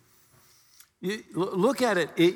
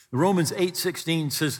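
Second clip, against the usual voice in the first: usual speaking voice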